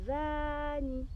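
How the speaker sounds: gently coaxing a rabbit by name. Shown as a demonstration. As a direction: draw out "«Vanille»" slowly, coaxing. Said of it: "vous lui dites".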